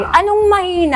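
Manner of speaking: mother snapping at the maid's excuse; angry retort. Retold or imitated. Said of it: "Minura ko na naman".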